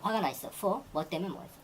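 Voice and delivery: ordinary talk, nothing marked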